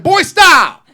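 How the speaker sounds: high, loud shout of a mother; mom yelling. Retold or imitated. Mama yelling at her son to stop, high and loud. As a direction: snap at the boy to stop.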